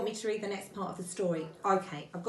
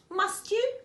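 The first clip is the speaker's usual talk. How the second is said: high, lilting polite query; 12 semitones higher, 4 dB louder than usual. A narrator's reading. Rabbit to Pooh who must be going, polite. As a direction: ask politely, pitched well up. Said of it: "said Rabbit politely".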